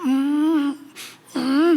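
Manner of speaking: drawn-out closed-mouth "mm"; mock a restrained groan in childbirth; politely stifled straining hum; genteel labor groan. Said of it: "점잖하게 애를 낳아야지".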